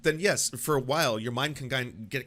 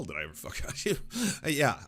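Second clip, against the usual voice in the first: usual speaking voice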